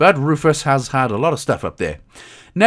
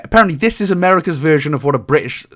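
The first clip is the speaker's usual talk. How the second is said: ordinary delivery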